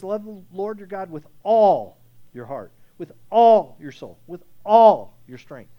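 loud, slow, each "all" driven home; preaching at full force. Acted. Pastor repeating the great command, loud and slow. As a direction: pound every "all" like a drumbeat.

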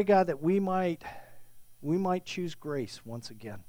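low, soft, halting prayer. Real, not acted. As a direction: pray quietly, pausing between phrases, fading to amen. Leads a prayer.

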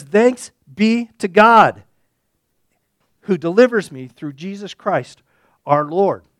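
loud, proclaimed with full force; triumphant thanks. Retold or imitated. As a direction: declare the thanks slowly and loudly. Paul's answer of thanksgiving.

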